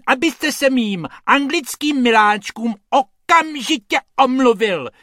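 high, loud offended lady; outraged high-pitched scolding; indignant screeching. A narrator's reading. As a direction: demand an apology in a piercing voice. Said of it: "ječí ta dáma silným hlasem".

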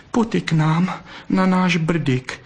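high, slow coaxing vixen; begging. A narrator's reading. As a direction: beg him to come along. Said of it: "žadonila". (žadonivě, vysokým hlasem)

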